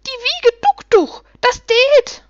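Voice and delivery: very high, loud, drawn-out gnome cheer; shrieking little creatures. A narrator's reading. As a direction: yell it gleefully as jubilant little creatures. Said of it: "schrien sie".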